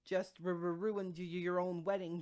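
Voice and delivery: halting, tripping over each word; a shocked stammer, restarting each word. A narrator's reading. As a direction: stumble through it, breaking up the words. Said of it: "Jennabelle stammered".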